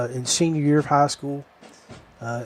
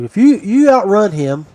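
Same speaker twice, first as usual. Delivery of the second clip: louder, higher sergeant making a deal; imitated drill sergeant; 5 semitones higher, 6 dB louder than usual. Head drill sergeant offers recruit a deal, imitated louder and higher. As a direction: lay down the bargain loudly, pitched up. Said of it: "he's like".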